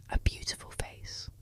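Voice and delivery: usual speaking voice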